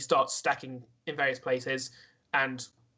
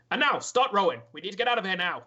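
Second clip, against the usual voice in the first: raised, pressing boat-boss command; urgent orders; 5 semitones higher, 4 dB louder than usual. Acted. Sailor telling the crew to row, urgently ordering. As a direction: get the whole crew moving. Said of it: "he's kind of ordering people".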